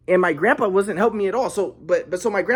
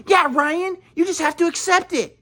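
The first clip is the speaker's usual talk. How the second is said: higher, parroting mock-calm voice; mocking parents; 9 semitones higher than usual. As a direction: echo grandpa mockingly. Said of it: "my mom and dad are like".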